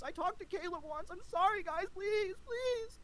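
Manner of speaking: very high whiny pleading, rising to a squeak; squeaky mock-begging imitation of a rival; grovelling impression. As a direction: beg for mercy like a coward. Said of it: "groveling, begging for forgiveness".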